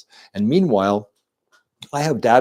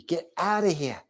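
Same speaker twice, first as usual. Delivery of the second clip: put-on higher-pitched Jersey accent; punchy Jersey accent, falling at each end; 6 semitones higher than usual. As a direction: play a Jersey boy with the accent. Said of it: "How do they say it in Jersey?".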